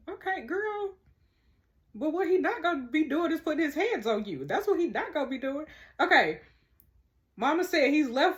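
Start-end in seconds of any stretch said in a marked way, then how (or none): 0.1-6.4 high-pitched sass, mostly fast; sassy. Real, not acted. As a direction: back her up with attitude. Siding with mom against stepdad.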